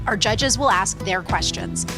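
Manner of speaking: ordinary talk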